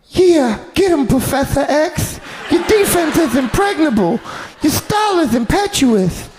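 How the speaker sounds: squeaky high Tyson cheering on his fighter; Mike Tyson voice. Retold or imitated. Tyson coaching [name] at the bag, squeaky high voice. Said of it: "he'd be like".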